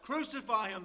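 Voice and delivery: high, forceful cry for a sentence; crowd's loud demand. Acted. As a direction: call it out as the crowd would.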